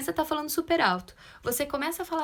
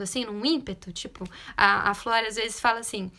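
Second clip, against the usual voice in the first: ordinary delivery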